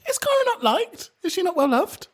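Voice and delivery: shrill mock-concern falsetto. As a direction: squeak it in mock sympathy. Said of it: "And I was like".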